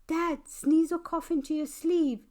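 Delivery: high, loud call to dad; a bossy child calling out; urgent warning. Acted. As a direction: urge dad to cover his sneeze.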